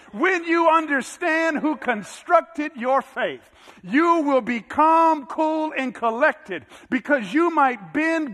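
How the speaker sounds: loud, high, rousing climax of the sermon; preaching at full force. Acted. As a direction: lift the congregation with conviction.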